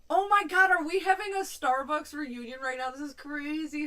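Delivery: high, loud burst of delighted surprise; excited squeal. Real, not acted. Spotting old Starbucks coworkers in the stream chat, a real excited shout. Friends join live Starbucks reunion.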